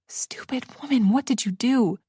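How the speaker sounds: unvoiced, hushed self-reproach; self-scolding whisper. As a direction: hiss it at yourself. Self-reproach under breath.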